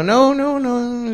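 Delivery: high, drawn-out denial; feigned innocence. Retold or imitated. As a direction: cover up with an over-innocent no.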